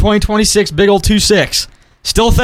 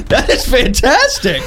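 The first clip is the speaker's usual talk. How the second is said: laughing; 10 semitones higher than usual. Real, not acted. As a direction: laugh out that is fantastic. Laughing at the logo.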